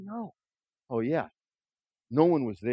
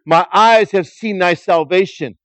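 loud, slow prophetic proclamation; a loud, drawn-out old prophet's blessing; solemn declaration; 5 semitones higher, 11 dB louder than usual. Acted. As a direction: lift the baby up and proclaim it. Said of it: "he declared".

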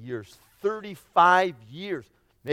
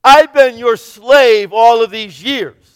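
slow, louder bitter complaint; resentful older son; 5 semitones higher, 9 dB louder, slower than usual. Acted. Older son complaining to his father, slow and bitter. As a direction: spit out the grievance slowly. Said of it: "The word he's going to use".

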